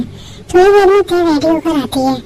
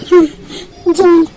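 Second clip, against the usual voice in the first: ordinary talk, nothing marked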